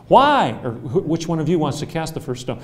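loud, high challenging why; loud mock-indignant why; indignant demand. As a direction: throw the question back at them. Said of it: "And I'm gonna say".

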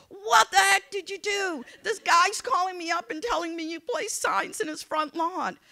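loud, high-pitched mock outrage; exasperated scolding. Candidate calling husband about the signs, acted exasperated outrage. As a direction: lay into him, loud and indignant.

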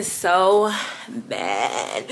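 slow, dragged-out complaint about the rain; drawn out. Real, not acted. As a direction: stretch every word slowly.

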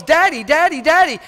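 put-on high, loud childlike pleading; little child calling daddy. Little kid calling out to his daddy, high and eager. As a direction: play a small child calling his dad. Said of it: "It's always".

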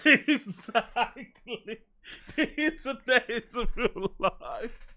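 slow, soft words squeezed through laughing; helpless laughter. Real, not acted. Host repeating a viewer's line, cracking up. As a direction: wheeze it out as you laugh.

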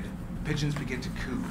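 ordinary talk, nothing marked

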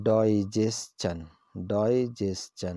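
digestion said slowly as di-JES-chun. Shown as a demonstration. Teacher slowly models digestion for viewers. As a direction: stretch out digestion for the learners. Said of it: "عام طور پہ ڈائجیشن کہلاتا ہے".

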